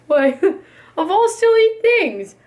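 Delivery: amused at the silly idea; laughing. A narrator's reading. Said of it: "The elephant laughed".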